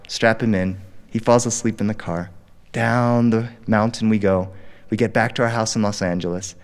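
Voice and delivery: usual speaking voice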